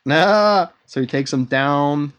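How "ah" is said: short loud high battle cry; mock action yell; fight yell. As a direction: burst out like taking a hit. Fight sound in retelling.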